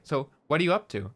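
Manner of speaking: "what are you" lifted, then "up to" falls; falling question tune, closing on "to". Shown as a demonstration. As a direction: let the question drop at "up to". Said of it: "And I'll, like, lower it".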